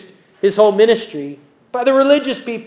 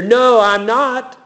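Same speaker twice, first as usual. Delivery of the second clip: a little angrier; 4 semitones higher, much slower than usual. Retold or imitated. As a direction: play Peter snapping back, slowly. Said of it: "he gets a little angrier".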